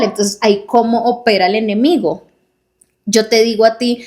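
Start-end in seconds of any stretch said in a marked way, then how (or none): none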